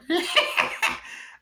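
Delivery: short high burst of maniacal cackling; Chucky. Retold or imitated. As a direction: imitate a killer doll's giggling cackle.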